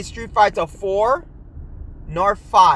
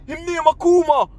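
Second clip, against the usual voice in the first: higher mock voice of a friend naming the fighter; mock broken English; 8 semitones higher, 4 dB louder than usual. Friend at the window tells kids the character, in a higher mock voice. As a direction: toss off the name in a put-on broken grammar, pitched up. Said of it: "And it's like".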